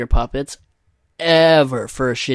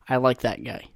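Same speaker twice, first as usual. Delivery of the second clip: ordinary delivery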